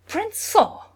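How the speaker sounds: sharp, loud angry snap. Acted. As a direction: bite the name off in anger. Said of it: "Lady Amordin snapped".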